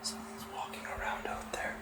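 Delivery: quick, nervous whisper; hushed and hurried. Real, not acted. Hiding, hearing footsteps outside.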